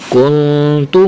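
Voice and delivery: very slow, drawn-out syllables for the class; teacher reading out words for children to repeat; fluent recitation. Shown as a demonstration. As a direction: stretch each word as a model reading. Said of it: "اب ایک مرتبہ روانگی کے ساتھ".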